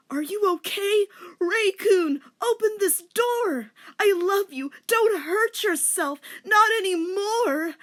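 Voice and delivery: high, loud frantic shouts; hammed-up yelling. Denmark shouting through the door to Rachel, frantic.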